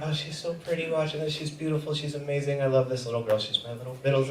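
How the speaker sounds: thinking fondly to himself; quiet doting thought; inner thought. Retold or imitated. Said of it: "I just think".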